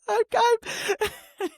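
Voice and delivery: high-pitched, playful choke-up with a laugh; jokingly overcome, voice jumping high; mock choked up. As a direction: pretend to well up with pride, laughing. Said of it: "I sound like I'm getting choked up".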